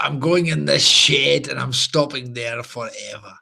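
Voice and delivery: put-on low, slow, gloomy old man grumble; miserable old man. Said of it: "old man did look miserable enough to be Scottish".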